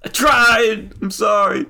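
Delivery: high, loud protesting apology; squeaky-high defensive sorry; pleading apology. Acted. Character apologises for short throw.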